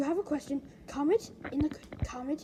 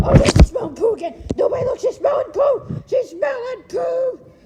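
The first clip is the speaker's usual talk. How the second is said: loud high disgusted yell; alarmed shout; 9 semitones higher, 11 dB louder than usual. Real, not acted. Kid yelling at viewers while the dog sniffs poo, disgusted. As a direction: shout a warning to everyone.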